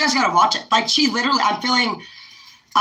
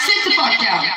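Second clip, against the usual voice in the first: louder, laughing "sit the fuck down"; laughing command; 3 dB louder than usual. Real, not acted. Vlogger acting the boss, laughing as she orders.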